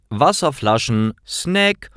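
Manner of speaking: ordinary delivery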